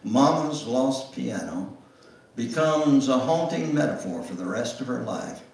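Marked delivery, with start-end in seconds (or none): none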